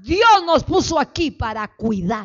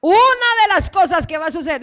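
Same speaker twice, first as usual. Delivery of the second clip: usual speaking voice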